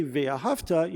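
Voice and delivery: "ve'ahavta" as ve-a-HAV-ta; stress on second-last syllable. Shown as a demonstration. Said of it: "So if I say".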